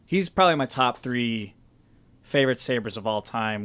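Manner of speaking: ordinary talk, nothing marked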